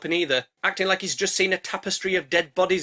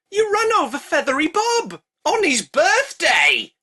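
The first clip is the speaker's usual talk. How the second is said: high, loud mock-outraged cry; hammed-up horror over a dead pigeon; outraged shriek; 11 semitones higher, 6 dB louder, slower than usual. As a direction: scream it like a tragedy happened.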